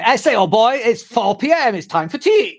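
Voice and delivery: mock stiff-upper-lip British accent, lifted pitch. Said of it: "pretend, you know, stiff upper lip".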